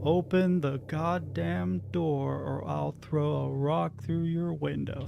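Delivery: quoting a clip. Retold or imitated. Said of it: "that Doctor Phil sound clip".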